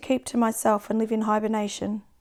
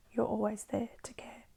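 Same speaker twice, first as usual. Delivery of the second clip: whispering; 11 dB quieter than usual. Acted. Poem line suddenly whispered.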